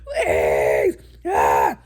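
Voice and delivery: very high, loud begging, building to a scream; retold screaming. Mother re-enacts pleading to get baby out, mock screaming. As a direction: act out desperate screams at the doctor. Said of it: "And I was screaming".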